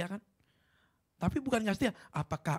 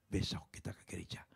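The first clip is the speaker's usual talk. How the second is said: whispered promise; 7 semitones lower, 7 dB quieter than usual. Retold or imitated. Mother answers daughter softly.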